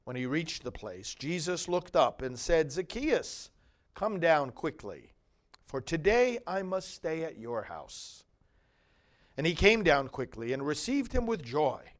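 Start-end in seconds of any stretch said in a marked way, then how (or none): none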